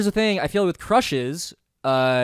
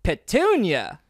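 John Mulaney impression; 4 semitones higher, 3 dB louder, much slower than usual. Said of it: "one line I can do of John Mulaney".

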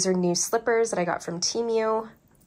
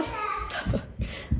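high airy giggle; 5 dB quieter than usual. Real, not acted. Laughs at own product name.

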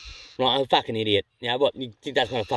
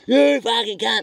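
much higher, louder mock yell of abuse; imitated abusive yell; 10 semitones higher, 4 dB louder, slower than usual. Landowner swearing at the fisherman's back, mock yell. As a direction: spit out the insult in his voice. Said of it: "Like that, mate".

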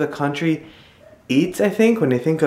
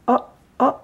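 usual speaking voice

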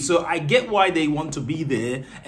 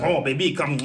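Pepe Le Pew; 2 semitones lower than usual. Retold or imitated. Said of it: "he'll be like".

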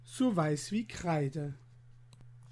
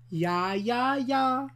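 tailor's call; 4 semitones higher, slower than usual. Acted. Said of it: "rief Meister Böck".

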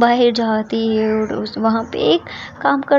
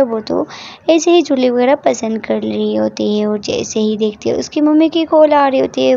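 ordinary delivery

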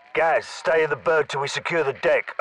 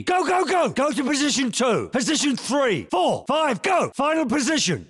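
shouted commands; 11 semitones higher than usual. Acted. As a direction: drive him on with yelled orders. Calling drill positions.

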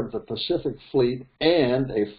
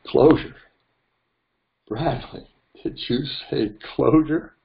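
incredulous; 4 semitones higher than usual. Real, not acted. Mocking the email's word back.

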